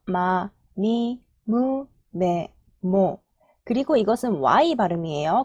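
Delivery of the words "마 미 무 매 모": 마, 미, 무, 매, 모 slowly, each vowel held; syllable-by-syllable demo. Shown as a demonstration. Teacher showing the M row to learners, syllable-by-syllable demo. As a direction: recite 마, 미, 무, 매, 모 one by one with M onsets. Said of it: "M 발음이 붙어서".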